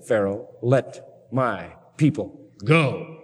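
slow, deliberate command to let them go; slow, stern Moses. Retold or imitated. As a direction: stretch every word like a warning.

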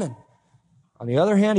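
usual speaking voice

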